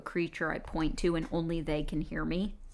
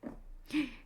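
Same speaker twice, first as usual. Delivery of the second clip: sharp gasp; 8 semitones higher, 7 dB quieter than usual. Real, not acted. Player reacts to attack on ally.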